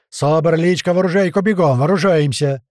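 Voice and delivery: rally the squad to arms; shouted orders. A narrator's reading. Commander shouting orders to armed squad. (громкая команда на бегу)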